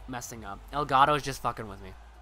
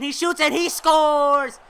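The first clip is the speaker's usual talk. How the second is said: loud, higher, drawn-out scores; goal call; 10 semitones higher, 10 dB louder, slower than usual. Acted. Announcer yelling the tying goal to viewers, slow and loud.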